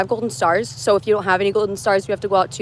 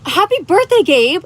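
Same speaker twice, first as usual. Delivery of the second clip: excited greeting; 8 semitones higher, 5 dB louder than usual. Real, not acted. Live stream birthday greeting.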